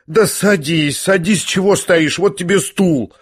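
usual speaking voice

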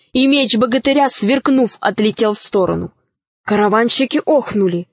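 usual speaking voice

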